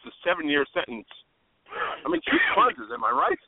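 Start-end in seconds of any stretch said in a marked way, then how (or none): 2.1-3.4 playful sing-song. Acted. Punchline sung out.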